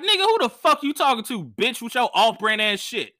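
high, heated imagined comeback; mock angry comeback. Comic imagining his angry reply, high and heated. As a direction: fire it back at him. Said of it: "my is like".

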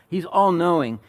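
ordinary delivery

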